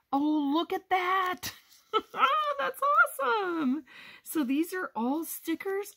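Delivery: very high, giddy squeal with a laugh; high-pitched gleeful gushing; excited squeal. Real, not acted. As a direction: squeal with delight at a surprise find. Discovers the pieces are stickers.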